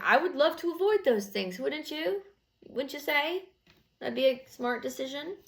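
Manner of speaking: high, sing-song sarcastic reasoning; sarcastic sweet. Retold or imitated. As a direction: talk down sweetly, mock-reasonable.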